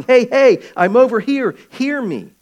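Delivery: loud, high calling out; shouting for attention. Retold or imitated. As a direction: yell to be noticed over a crowd. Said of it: "so busy shouting".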